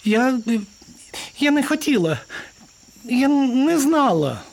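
very high, halting mouse voice; scared little mouse. Acted. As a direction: squeak out a faltering excuse. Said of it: "маленьку злякану мишку". (злякана мишка тоненьким голоском)

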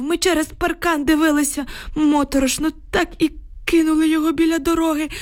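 put-on high-pitched old man's voice, upset; agitated old village man. A narrator's reading. Shaken neighbour reports to the women, agitated old man.

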